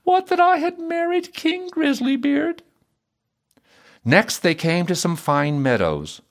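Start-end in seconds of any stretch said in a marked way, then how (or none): 0.1-2.6 high-pitched wistful princess; sighing regret. A narrator's reading. Princess married to a fiddler, sighing with regret. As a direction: wish it undone in a very high voice. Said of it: "sighed she".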